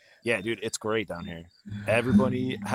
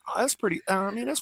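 high-pitched; 13 semitones higher, faster than usual. Real, not acted. Excited answer about the weed.

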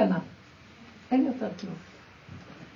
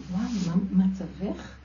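self reproach; 2 semitones lower, 3 dB quieter, slower than usual. Retold or imitated. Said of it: "אמרתי".

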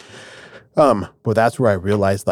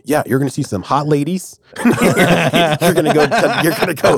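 higher, laughing surfer-dude deity; laid-back dude God; 6 semitones higher, 3 dB louder than usual. Pretend. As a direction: play God as a chill buddy, laughing. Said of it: "And then there's like".

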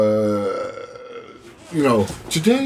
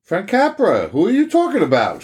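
surprised; 7 semitones higher than usual. Real, not acted. Confused outburst.

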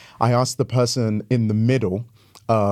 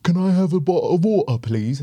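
mock Cockney, pitched up and fast; Cockney accent; 6 semitones higher than usual. Pastor mimics how people hear him, mock Cockney. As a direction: ham up a Cockney asking for water. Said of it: "they will often go".

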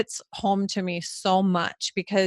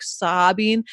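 ordinary delivery

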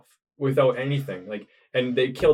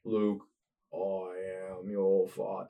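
slow, low, put-on villain voice; slow menacing movie villain line; Darth Vader voice; 4 semitones lower, 9 dB quieter, much slower than usual. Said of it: "I sound like Sylvester Stallone".

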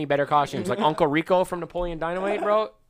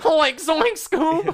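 slow, high mock Shaggy; 12 semitones higher, much slower than usual. Friend doing Shaggy for the podcast, high and slow. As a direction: stretch it out like Shaggy from Scooby-Doo. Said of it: "it's doing the accent".

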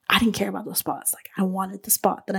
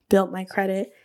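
ordinary delivery

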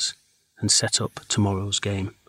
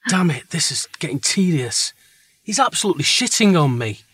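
loud, high exasperated rant; frustrated; 7 semitones higher, 5 dB louder than usual. Acted. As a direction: vent at losing the game. Character frustrated at game.

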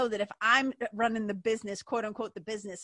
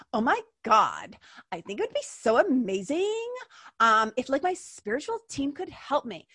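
airhead talk; 3 semitones higher, 3 dB louder than usual. Pretend. Lightly mocking a dreamy seeker who won't act, gushing. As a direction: swoon over the idea, no plan to act. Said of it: "Sometimes I just like to talk like that".

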